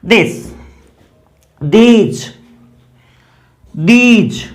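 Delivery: दिस and दीज spoken carefully, d not th; modelling th sound. Shown as a demonstration. Teacher modelling this and these for students. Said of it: "दे का symbol ये होता है".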